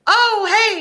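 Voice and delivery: high, loud, over-eager hello; mock gushing greeting. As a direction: burst in as if sharing with strangers. Mock chatty greeting to strangers.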